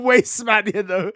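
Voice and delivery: giggly mock London roadman; roadman accent. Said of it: "a road man".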